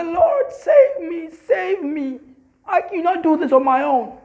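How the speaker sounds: high, urgent plea to God; pleading prayer. Shown as a demonstration. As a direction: beg for rescue, earnestly.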